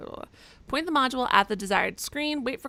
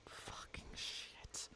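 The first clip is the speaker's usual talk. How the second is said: slow hushed curse; whispering; 25 dB quieter, much slower than usual. Real, not acted. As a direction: breathe the curse out. Whispered curse after bug.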